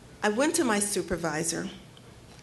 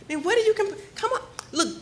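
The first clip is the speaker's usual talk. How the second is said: high, laughing, patronizing boss voice; dismissive supervisor, mocking and amused; condescending brush-off; 7 semitones higher than usual. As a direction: pitch way up and laugh her off.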